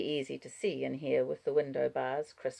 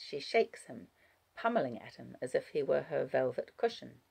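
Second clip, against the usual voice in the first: ordinary delivery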